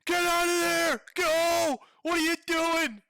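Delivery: loud, high, slow frantic yell; yelling at the screen. Retold or imitated. Viewer yelling at the girl in the horror film, loud high frantic warning. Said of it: "you're like".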